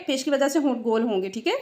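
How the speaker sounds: usual speaking voice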